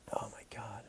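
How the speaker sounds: breathless stunned whisper; a hushed, voiceless oh my God. Real, not acted. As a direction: whisper it in disbelief. Stunned by the house price.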